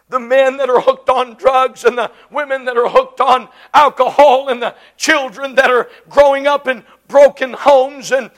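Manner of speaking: ordinary talk, nothing marked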